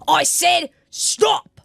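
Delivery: slow, high, enraged yell; furious shout. Acted. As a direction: roar it out in rage. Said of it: "rage surging through him".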